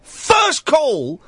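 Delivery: high, exasperated outburst; exasperated shout. Real, not acted. Host after the botched first call, exasperated shout. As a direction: burst out in disbelief, pitched high.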